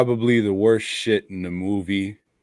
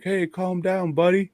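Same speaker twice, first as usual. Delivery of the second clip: higher, gentle mock comfort to a dog; soothing the dog; 10 semitones higher than usual. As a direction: coax the little beagle. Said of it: "it's just there like".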